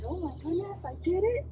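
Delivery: lightly voiced astonished dog; dog's inner voice. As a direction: speak for the dog, a touch amazed. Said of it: "she's like".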